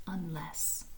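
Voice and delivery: soft low single word; softly low and solemn; hushed. Acted. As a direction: let it hang, hushed. The stone's one word.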